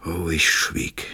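slow, breathy whisper; slow hushed narration whisper. A narrator's reading. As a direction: let the words barely leave you. Narrator falls silent in grief.

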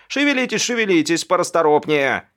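high, urgent call to hurry; shouted order. A narrator's reading. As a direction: bossily shout your crew along. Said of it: "и прикрикнул". (начальственный окрик подчинённым)

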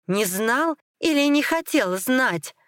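high, drawn-out accusing question; angry challenge. A narrator's reading. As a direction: throw his excuse back at him. Furious accusation in the quarrel.

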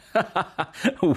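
high burst of laughter; hearty laugh. Acted. Jack laughing at the soap story, hearty high laugh. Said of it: "Jack rideva così di cuore".

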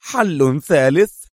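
high, drawn-out incredulous question; astonished disapproval. A narrator's reading. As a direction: echo it slowly with a rising, doubtful tone. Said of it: "مزيج من الدهشة والاستنكار".

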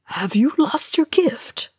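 high-pitched shocked question. A narrator's reading. Ori to Thorin whom Gimli cannot hear, shocked. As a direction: ask it high, aghast. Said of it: "said Ori in shock".

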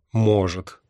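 plain reading voice

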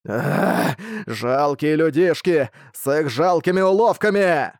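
sneering monster, drawn out; booming arrogant alien overlord. Acted. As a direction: scorn the puny humans.

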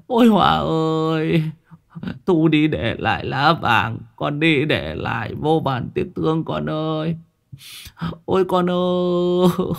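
wailing lament. Acted. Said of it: "nấc lên nấc xuống".

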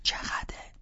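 urgent hushed whisper. Acted. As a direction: keep it down, parents are home.

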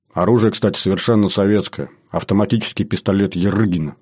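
ordinary delivery

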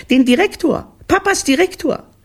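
put-on very high, loud kid voice; excited children. Children answering their mother, high and excited. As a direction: pipe up at the top of the voice.

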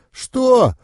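high, loud indignant outburst; sudden high-pitched anger; angry growl. A narrator's reading. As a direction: fire it back as an angry mentor. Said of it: "гневно прорычал наставник".

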